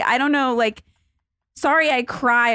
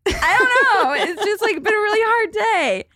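mock-sobbing to her parents about her hard day; teary whimper; 11 semitones higher than usual. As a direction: wail it like a weepy kid. Retold teary call to parents.

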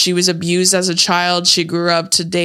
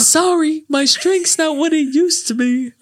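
put-on old man voice; 9 semitones higher than usual. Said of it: "He's all".

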